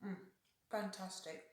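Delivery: talking with a mouth full of food; chewing while speaking. Real, not acted. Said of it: "Excuse me talking with my mouth full".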